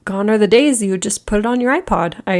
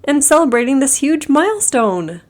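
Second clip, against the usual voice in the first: excited; 6 semitones higher than usual. Real, not acted. Celebrating hundredth podcast episode.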